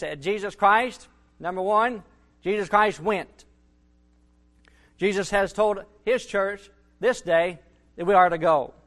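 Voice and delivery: ordinary talk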